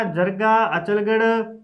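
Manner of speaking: ordinary talk, nothing marked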